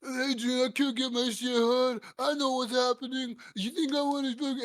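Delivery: high, pleading dopey Rocky; hammed-up Rocky calling Adrian. Rocky complaining to Adrian, high pleading mock voice. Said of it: "the whole time he's like".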